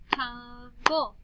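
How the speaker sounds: slow "Tum-ble" for clapping; clapped syllables. Shown as a demonstration. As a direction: TUMBLE slowly as tum-ble. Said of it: "then we'll clap the syllables".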